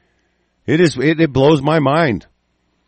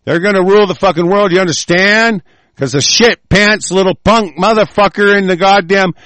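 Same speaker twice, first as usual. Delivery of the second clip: furious rant; 8 semitones higher, 5 dB louder than usual. Real, not acted. Angry rant opening.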